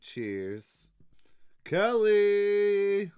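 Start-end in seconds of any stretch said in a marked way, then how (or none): 1.6-3.1 high, loud shouted name; hype shout. Acted. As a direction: belt the name out like a hype man. Reading patron names, hyped.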